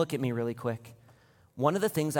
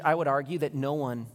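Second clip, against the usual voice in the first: ordinary delivery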